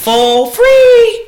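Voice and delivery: high, loud, drawn-out delight; sing-out emphasis, pitch soaring; excited exclamation. Real, not acted. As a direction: belt it out in amazement. Marvelling at a free venue.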